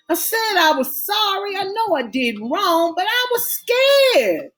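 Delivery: put-on desperate pleading boyfriend; pleading young man. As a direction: beg her to understand. Blaine pleads, voice raised high.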